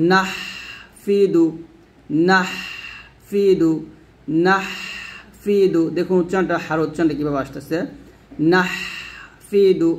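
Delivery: নাহফিদু with the হ pressed from the throat. Shown as a demonstration. A teacher modelling the throat হ in নাহফিদু. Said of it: "হাটা চাপ দিয়ে".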